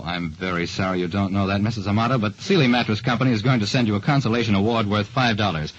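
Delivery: usual speaking voice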